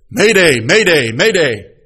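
loud urgent mayday call; distress call. Acted. Coast guard radio distress call, loud and urgent. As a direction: shout it like a sinking ship's radio call.

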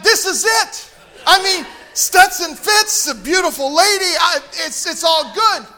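hammed-up hopeful excitement; excited. Excited about the breakdown.

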